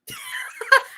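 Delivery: sudden high squealing laugh; a high, sharp laugh; high burst of laughter. Real, not acted. Laugh after I cussed.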